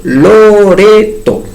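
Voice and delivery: Loreto split slowly into its syllables, Lo-re-to. Shown as a demonstration. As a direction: stretch Loreto out, one beat per syllable. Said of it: "tres sílabas". (Loreto dicho lento, sílaba por sílaba)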